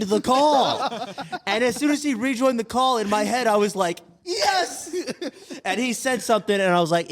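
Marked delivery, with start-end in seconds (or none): 4.2-4.9 high, delighted squeal; giddy high yes with a laugh; joyful yes. Retold or imitated. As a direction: squeal with relief. Relief as Esai rejoins.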